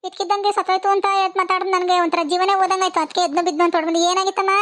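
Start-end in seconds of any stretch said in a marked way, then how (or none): none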